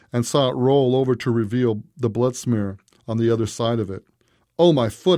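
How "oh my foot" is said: louder, higher cry of pain; a contained narrator's yell. A narrator's reading. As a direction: yelp out over a throbbing foot. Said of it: "I yelled, feeling the throbbing".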